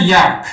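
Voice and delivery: یک sounded "yak" for learners; English pronunciation demo. Shown as a demonstration. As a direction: say یک as "yak", with an open a.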